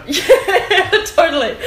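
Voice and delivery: loud, high words through laughter; laughing agreement. Real, not acted. Friend going along with the remix teasing, laughing. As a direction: play along while giggling.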